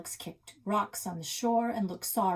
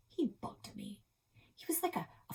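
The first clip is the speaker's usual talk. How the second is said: whisper; 10 semitones higher, 6 dB quieter than usual. A narrator's reading. Annoyed whispered aside.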